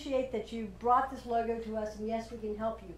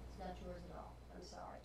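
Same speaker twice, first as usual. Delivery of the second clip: somber, hushed; 4 semitones lower, 14 dB quieter than usual. Acted. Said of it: "take the tone of voice down".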